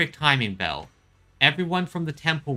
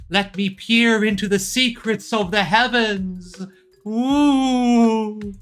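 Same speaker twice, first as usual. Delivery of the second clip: higher, louder mystical seer voice; mystic fortune teller; 7 semitones higher, 4 dB louder, slower than usual. Acted. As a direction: ham up the divine vision. Fortune teller peering into fate.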